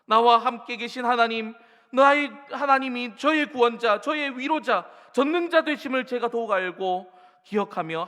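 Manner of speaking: full-force sermon prayer; fervent preaching. Acted. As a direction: pour out the prayer from the pulpit.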